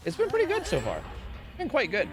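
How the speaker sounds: lilting high singsong. Acted. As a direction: sing it up high and light. Cheerful singsong status update.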